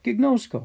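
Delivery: teacher sounding out "Gignosko"; Greek word gignosko. Shown as a demonstration. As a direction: lift "Gignosko" to catch attention. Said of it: "Think about the word".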